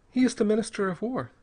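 put-on dreary girl. A narrator's reading. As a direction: explain drearily, pitched well up. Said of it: "said the girl drearily".

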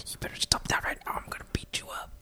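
harsh whispered threat; whisper yelling. Shown as a demonstration. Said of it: "when you're in a quiet place".

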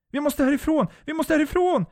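very high, loud hysterical cry; panicked scream. A narrator's reading. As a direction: scream to get away, in full panic. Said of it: "skriker Jennifer". (hysteriskt skrik)

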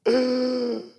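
sharp mock gasp; a staged, startled intake of breath; shocked gasp. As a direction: gasp as if stunned by the news.

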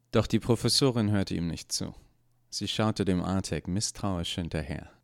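plain reading voice

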